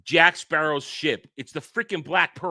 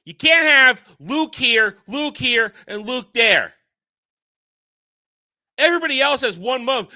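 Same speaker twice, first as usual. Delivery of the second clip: outraged rant; 7 semitones higher, 5 dB louder than usual. Real, not acted. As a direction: spell out the absurdity angrily. Angry rant about three Lukes.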